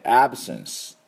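"absence" slowed down, first vowel an open ae like Nagoya e; teacher's slow demo of "absence"; model pronunciation. Shown as a demonstration. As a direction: stretch the first ae in "absence", then a light sence. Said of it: "最初のえの音がポイントですね".

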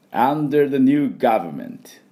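「under the new government」 slow, 「new」 stressed, clear v; slow model phrase. Shown as a demonstration. Teacher repeats the phrase slowly for the class. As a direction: draw out each word, bite the v in 「government」. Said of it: "じゃあ行きますよ".

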